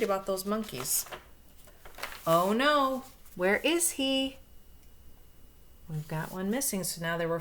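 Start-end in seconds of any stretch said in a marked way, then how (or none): 2.3-4.4 higher, louder oh no; mock alarm. Reader to children, mock alarm at a missing monkey. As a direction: play up the worry for the kids.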